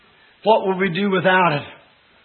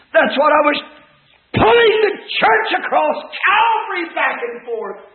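high, raised pulpit voice; fervent preaching; 6 semitones higher, 3 dB louder than usual. Real, not acted. Preacher recalling the morning's message, fervent raised cry. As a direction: cry it out to the whole church.

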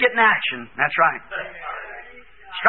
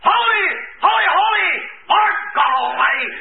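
high cry on the first holy, easing off; hollered praise; 7 semitones higher than usual. Retold or imitated. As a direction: angel hollering holy once more. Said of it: "he hollered again".

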